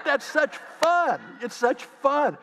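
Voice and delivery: high and drawn out on "fun"; gleeful exclamation. Retold or imitated. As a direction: exclaim it slowly with relish. Preacher exclaims his delight.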